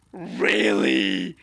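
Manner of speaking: loud, low, roughly forced shout; exclaimed shock. Acted. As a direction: blurt it out in hammed-up disbelief. Acts out shocked reaction, very loud.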